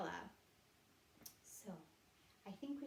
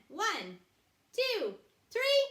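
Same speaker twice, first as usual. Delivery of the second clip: building shout on three; loud countdown; 11 semitones higher, 13 dB louder, much slower than usual. Acted. Counting down to cheer.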